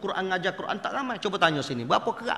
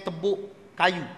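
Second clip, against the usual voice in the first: ordinary talk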